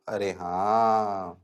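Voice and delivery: low, soft, drawn-out agreement; mock realisation. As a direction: play a student slowly catching on, quietly. Voices student's dawning agreement.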